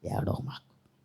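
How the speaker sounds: gentle Jesus. Acted. Jesus whispering softly to the weeping woman, low and tender. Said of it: "ఆమెను చూసి అంటాడు".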